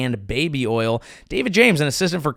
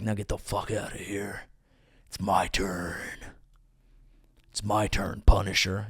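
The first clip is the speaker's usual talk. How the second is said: low, muttered command; 6 semitones lower, 8 dB quieter than usual. Retold or imitated. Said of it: "they pay him to be like".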